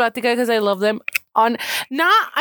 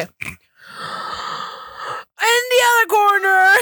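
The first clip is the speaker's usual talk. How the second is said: hype announcer; 6 semitones higher than usual. Pretend. Introducing the player in the other corner, hammed-up announcer. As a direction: boom it out like a fight introduction. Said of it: "I should be an announcer".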